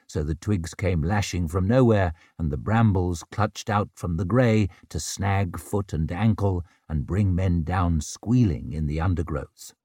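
plain reading voice

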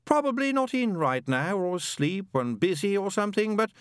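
high, drawling recorded message; laid-back answerphone. Acted. Demon's machine talks over the frantic angel, laid back. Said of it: "sounded so laid back".

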